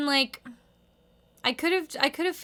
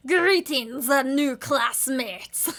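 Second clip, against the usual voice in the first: best Dexter voice; slower than usual. Acted. Reading the comic aloud to viewers, slow stiff Dexter accent. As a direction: give the greeting in a stiff, put-on Dexter accent. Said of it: "That's my best Dexter".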